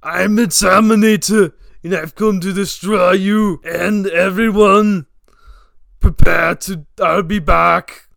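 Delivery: put-on Austrian accent, robot-killer bravado; heavy Austrian accent, steady and menacing; Austrian-accented Terminator threat; Arnold Schwarzenegger. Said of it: "I am Arnold Schwarzenegger".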